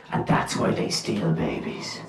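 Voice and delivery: hushed spooky whisper. Acted. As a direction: whisper it like a ghost story. Spooky folklore telling.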